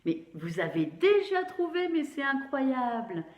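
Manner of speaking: high, delighted cheer for the class; high-pitched teacher delight at the pupils; excited praise. Real, not acted. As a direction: cheer them on, amazed.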